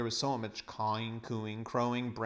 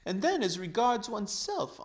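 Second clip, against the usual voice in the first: high, loud, gloating schemer's voice; a pompous old man crowing over his fortune; greedy old uncle; 11 semitones higher, 4 dB louder than usual. Acted. Uncle Andrew boasting to the children about riches, gloating.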